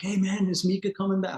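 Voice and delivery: high-pitched, eager imitation of the boss; excited. Boss eagerly asking after his dog, excited. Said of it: "he was all excited to see her".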